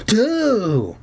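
high, stretched countdown call; drawn-out count. Acted. Zeke counting the group in for a push, drawn-out count.

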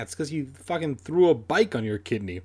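usual speaking voice

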